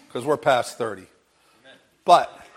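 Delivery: ordinary delivery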